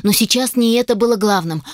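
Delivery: ordinary delivery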